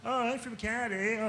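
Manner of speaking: hammed-up innocent Canadian voice; naive Canadian. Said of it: "I'm like".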